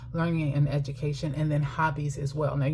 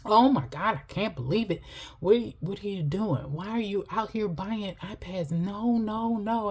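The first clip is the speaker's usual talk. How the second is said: higher, whiny mock outrage at the iPad purchase; mock temper tantrum; 5 semitones higher than usual. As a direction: imitate a husband throwing a fit, hammed up. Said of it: "he threw a temper tantrum".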